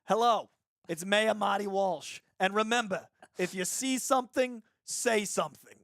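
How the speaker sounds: imitating the mayor's thick Boston accent on the train PA; Marty Walsh Boston accent. Mayor's train announcement retold, in a thick Boston accent. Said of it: "there'd be this thick Boston accent from the mayor going".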